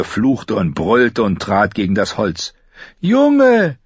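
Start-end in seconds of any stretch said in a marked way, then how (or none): 3.0-3.8 very high, loud plea; calling out. A narrator's reading. Chained prisoner calling to the child, pleading shout. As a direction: cry out for rescue. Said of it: "rief Jaqen Hagar".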